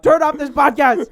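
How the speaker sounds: loud, slow mock shout at sleepers; mock yell. Joking about waking sleeping listeners: slow, loud mock shout. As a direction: bellow it to wake them up.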